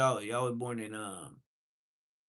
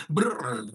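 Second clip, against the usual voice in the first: gunshot noise; 6 dB louder than usual. Acted. Imitated gunfire.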